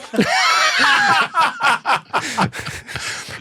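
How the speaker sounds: high, long, whooping laugh; real helpless laughter breaking out; a big shrieking laugh. Real, not acted. Bursts out laughing at friend's refusal.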